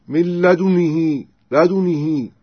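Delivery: i after the ن and long ee on the ه of لدنه; kasra with lengthened ha. Shown as a demonstration. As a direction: stretch the end of لدنه into "hee". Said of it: "ويكسر الهاء ويصلها".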